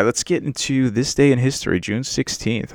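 usual speaking voice

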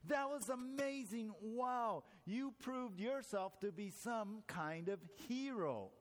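high-pitched, impressed billionaire; amazed congratulation. A narrator's reading. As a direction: marvel at the hero, slowing towards the end.

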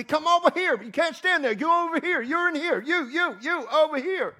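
high, loud barking guard orders; imitating yelling guards. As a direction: mimic the airport guards shouting commands. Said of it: "people yelling at me".

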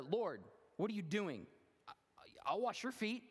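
high, loud, flustered protest; protesting Peter. Acted. Peter protesting as Jesus kneels to wash his feet, high and flustered. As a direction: Peter objecting in disbelief. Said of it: "again, he started".

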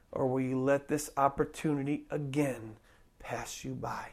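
low, soft, weighty preaching with long pauses; hushed altar appeal. Real, not acted. Preacher asking listeners to answer the call, hushed appeal.

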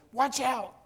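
high, urgent call while pointing at them; pointed warning. Retold or imitated. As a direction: call out the danger sharply. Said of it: "Maybe he pointed at them and said".

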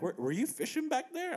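high, light voice for the stranger; stranger's voice. Retold or imitated. Stranger on the trail asks the angler, high-pitched. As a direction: lightly play the curious stranger meeting you on the trail. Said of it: "he's like".

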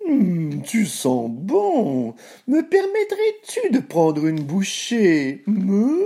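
slow, high mooing voice; hungry cow. Acted. Cow asks the cookie for a bite, slow mooing. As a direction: draw the words out like moos.

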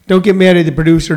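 usual speaking voice

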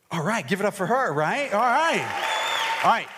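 high-pitched hype for the crowd; rally the room to clap; rousing applause call. Real, not acted. Calling for applause for volunteer.

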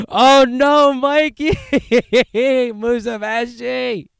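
high, loud mock scream of the name; mock shrieking. Mock screaming imitation of Mikey.

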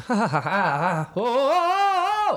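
short panting cries rising into a long high wail; hammed-up panic, gasps stretching into a high howl; mounting panicked yell. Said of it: "I started yelling as loud as I could".

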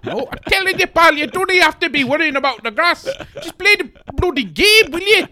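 very high, squawking comic scolding; exasperated Geordie. Acted. Geordie tells the player to stop worrying, high-pitched scolding. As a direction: scold shrilly in a hammed-up Geordie voice.

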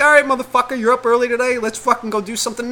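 high, excited dog voice imagined; dog's voice. Retold or imitated. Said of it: "being like".

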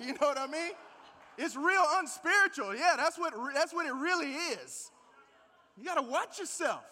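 loud, driving sermon delivery; preaching at full force. Acted. Pastor rails against excuses to his church, loud preaching. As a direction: hammer the point home to the congregation.

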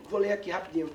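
low, hurried, offhand; quick casual reading announcement. Real, not acted. Teacher announcing he will read the story, quick and offhand. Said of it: "Rapidinho não".

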